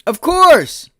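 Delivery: real furious scream. Real, not acted. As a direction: let it rip at the top of your voice. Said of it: "Sorry, I don't mean to yell".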